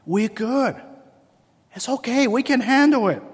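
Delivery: high put-on voice of overconfidence; breezy brush-off. Adam and Eve answering God's warning, breezily overconfident.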